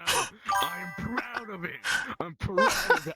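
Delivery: high-pitched, hammed-up proud outcry; mock-emotional declaration. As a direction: declare it defiantly, voice leaping up high. Hammed-up defiant confession.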